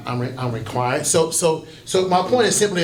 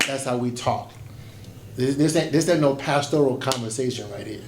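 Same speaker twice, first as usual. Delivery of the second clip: usual speaking voice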